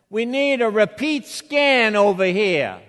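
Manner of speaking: put-on loud, high call in a Revere accent; loud public announcement. Security guy over the microphone for all to hear, loud announcement. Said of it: "for all the world to hear over the microphone".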